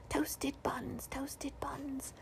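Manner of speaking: soft unvoiced echoing whisper; whispered ghostly echo. A narrator's reading. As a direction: breathe it like a far-off echo. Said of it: "ghostly echoes whispering".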